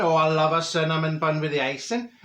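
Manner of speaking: usual speaking voice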